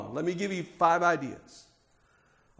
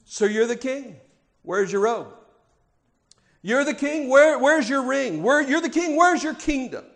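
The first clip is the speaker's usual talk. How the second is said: mocking Pilate; 6 semitones higher, 4 dB louder than usual. Pilate mocking Jesus, voiced.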